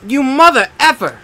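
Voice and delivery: high, loud furious yell; real rage at the game; angry shout. Real, not acted. Gamer yelling at the game after losing, real angry shout.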